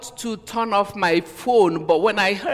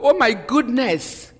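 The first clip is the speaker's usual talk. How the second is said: higher and louder, slowing on the last words; shocked outcry; 7 semitones higher, 6 dB louder than usual. Retold or imitated. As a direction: cry out in hurt disbelief. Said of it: "I was like".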